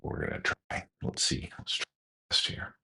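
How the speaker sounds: soft breathy whisper while handling the bird. Real, not acted. Breeder whispering while banding and photographing a pigeon, soft whisper. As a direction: whisper so the pigeon stays calm. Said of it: "we're gonna be very quiet".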